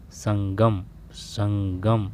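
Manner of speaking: ordinary talk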